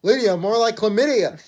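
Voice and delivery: punchline shouted out loud; loud joke. Real, not acted. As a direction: yell the punchline for laughs.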